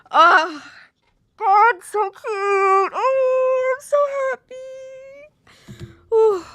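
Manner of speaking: slow, high, stretched squeal of delight; swooning happy squeal; drawn-out squeal. Real, not acted. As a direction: melt over the cute moment.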